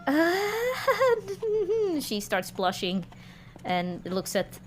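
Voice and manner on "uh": a sudden high flustered squeak. Acted. Vilma caught off guard by the old flame question, squeaking. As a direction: squeak with embarrassment.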